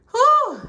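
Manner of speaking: high breathless whew after work; exhausted whew. Real, not acted. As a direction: blow out a tired whew. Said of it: "I'm out of breath".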